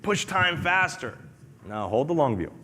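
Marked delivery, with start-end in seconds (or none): 0.0-1.2 imitating an impatient guy, higher, wishing time away; mock impatient guy. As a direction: play the guy who wants time to hurry up, slow on the last words. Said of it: "He's like".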